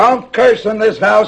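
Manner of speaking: loud, high, drawn-out rebuke; angry scolding. Acted. Father scolding grown son.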